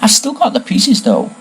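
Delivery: high-pitched, hopeful brightness; brightly. A narrator's reading. Expelled giant owns up to keeping the pieces, brightly. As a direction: perk up after being scolded. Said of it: "he added brightly".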